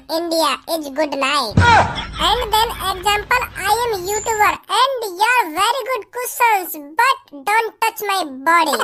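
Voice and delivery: slow, halting mock English; slow nonsense English. Boy fakes English to impress the girl, slow and halting. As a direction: fake confidence in gibberish English.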